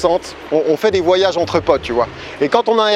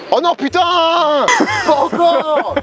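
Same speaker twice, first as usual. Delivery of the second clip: exasperated shout; 10 semitones higher than usual. Retold or imitated. Friends hear Romain in toilet.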